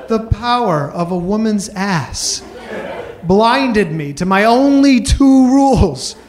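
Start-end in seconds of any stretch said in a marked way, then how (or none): none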